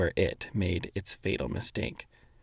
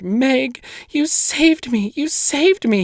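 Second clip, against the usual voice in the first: put-on very high, slow child's voice; a small boy overjoyed; little brother thanking his sister; relieved little boy; 5 dB louder, slower than usual. A narrator's reading.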